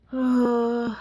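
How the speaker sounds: a long tired sigh. Real, not acted. Reader sighing after the sick-child memory, long sigh. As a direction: let out a weary breath.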